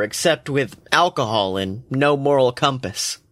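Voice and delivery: ordinary delivery, nothing marked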